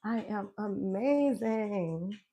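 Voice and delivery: very slow, drawn-out "amazing" rising at the end; slow proclamation. Shown as a demonstration. Declaring her motto to the listener, slow and savoured. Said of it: "continue to express myself in that way".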